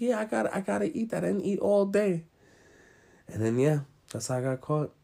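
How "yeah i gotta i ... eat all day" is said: high-pitched retold excuse; defensive excuse. Kid explaining the food to mom, high and defensive. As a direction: plead innocence in a raised voice.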